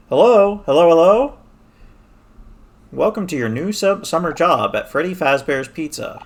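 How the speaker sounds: loud, high phone guy call; Phone Guy impression. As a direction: call out like the night guard's recorded greeting. Quoting the FNAF phone call.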